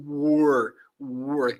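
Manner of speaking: "were" with a rich, rolled-out final R; careful R. Shown as a demonstration. Teacher drilling the R sound for students. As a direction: linger on the R of "were". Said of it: "e a gente capricha nesse R".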